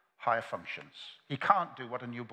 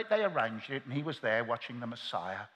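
ordinary delivery

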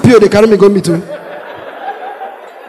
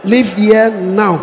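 slow repeat; 2 semitones lower, much slower than usual. Retold or imitated. Repeated slowly.

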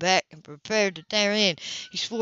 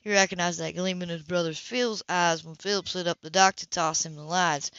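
ordinary delivery, nothing marked

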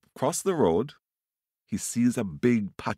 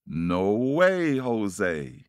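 emphatic refusal; much slower than usual. Acted. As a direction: refuse with a big rise and fall. Dog refuses the bird's plea.

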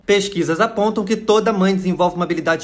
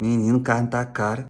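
much lower, slow aside; intrusive inner aside; 11 semitones lower, 4 dB quieter than usual. Acted. Meditator distracted by the price of meat, low slow aside. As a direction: let it slip out low and drawn out.

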